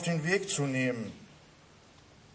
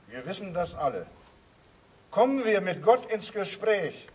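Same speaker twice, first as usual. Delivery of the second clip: normal talking voice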